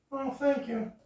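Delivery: high-pitched frog puppet chatter; squeaky puppet greeting his cousin. Acted. Puppeteer voices Kermit to host, high puppet voice.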